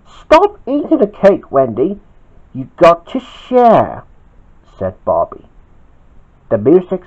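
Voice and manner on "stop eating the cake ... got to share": pitched-up storybook telling-off; mildly indignant order; scolding. Acted. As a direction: scold lightly, slowing at the end. Bobby scolds Wendy in story.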